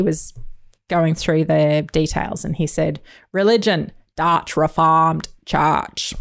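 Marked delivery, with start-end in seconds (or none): 3.3-6.1 slow, stretched-out bad Dutch accent. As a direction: mimic a TV character's clumsy Dutch accent. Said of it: "which is his bad accent".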